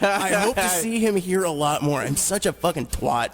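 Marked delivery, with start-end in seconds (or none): 0.0-2.0 imitating his own stiff guest intro; hammy radio host intro, higher; cheesy host voice. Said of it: "I said".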